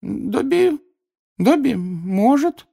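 high, slow elf voice, drawn out; wavering on the decision, squeaky and slow; uncertain Dobby. A narrator's reading. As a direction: stretch the words hesitantly as a house-elf. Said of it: "неуверенно протянул он".

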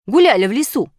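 loud, curt reply; grumbled. A narrator's reading. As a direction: brush off the question grumpily. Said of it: "буркнула буква".

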